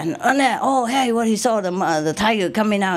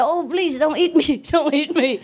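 higher, laughing mock begging; mock terrified plea; 9 semitones higher than usual. Man in gorilla suit begs the tiger, mock terrified pleading. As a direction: play the scared man pleading with the tiger, half joking. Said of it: "He talked loud".

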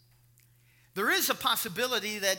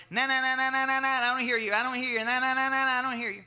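loud, higher sing-song chant; childish taunt; 5 semitones higher, 6 dB louder, faster than usual. Retold or imitated. Blocking out his teasing friend with hands at his ears, childish. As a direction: drown him out like a stubborn kid. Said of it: "very childish".

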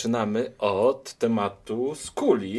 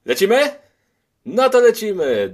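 excited kickoff; 12 semitones higher, 10 dB louder than usual. Acted. Energetic start of the show.

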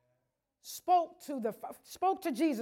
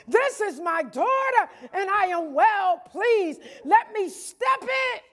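shouted, higher, louder preaching; full-force preaching; 6 semitones higher, 8 dB louder than usual. Acted. Pastor shouting the Lord's proclamation to the congregation at full force. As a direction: shout it to the rafters.

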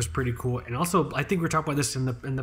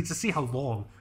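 normal talking voice

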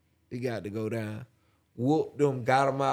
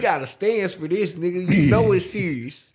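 higher, louder hammed-up bravado; mock gang-banger voice; 5 semitones higher, 6 dB louder than usual. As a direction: act the player squaring up in a stance.

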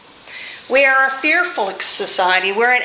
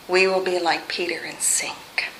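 breathy whispered drop; 4 semitones lower, 6 dB quieter than usual. Acted. Preacher whispers to the congregation as Peter sinks. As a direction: let the words sink into a whisper. Hushed sermon point.

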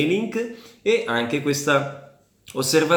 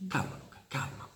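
hushed calming; 16 dB quieter than usual. Real, not acted. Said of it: "calming his son".

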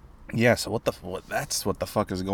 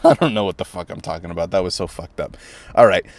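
ordinary talk, nothing marked

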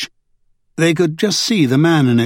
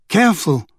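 mild shout; 5 semitones higher than usual. A narrator's reading. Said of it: "Kate shouted".

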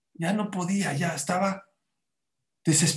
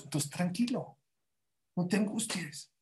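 much softer, calming tone; soothing reassurance; 10 dB quieter than usual. Real, not acted. Preacher telling listeners not to worry, soothing. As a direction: drop the volume and reassure gently.

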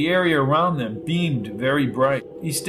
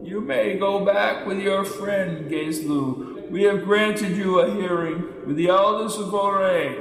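ancient elder; 5 semitones higher than usual. A narrator's reading. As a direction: intone like an ancient sage. Elder speaks.

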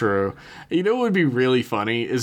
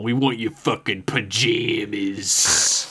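menacing thug; slower than usual. Retold or imitated. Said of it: "the leader's like".

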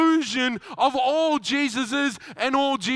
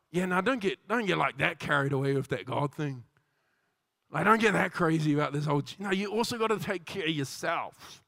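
mock cautioning mate; 6 semitones lower, 4 dB quieter than usual. Mate warning a friend off the God thing, lower. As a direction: lower and softer, casually discouraging. Mates discouraging devotion.

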